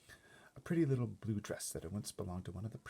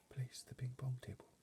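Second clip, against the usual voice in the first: higher, hushed whisper; 5 semitones higher, 8 dB quieter than usual. A narrator's reading. Butler instructing footmen, whispered. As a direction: breathe the order softly. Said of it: "Mister Tibbs whispered".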